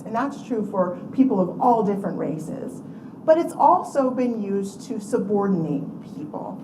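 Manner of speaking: usual speaking voice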